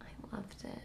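breathy little whisper; secretive whispered aside. Real, not acted. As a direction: say it under your breath, half to yourself. Defiant aside against friend's opinion.

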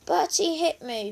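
high, tale-telling whine; high-pitched childish complaint. A narrator's reading. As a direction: whine it to Mum. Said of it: "whined Bella".

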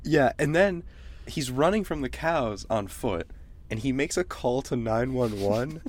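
usual speaking voice

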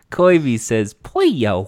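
KOBE as "koy-bee", POLLO as "poy-yo". Pretend.

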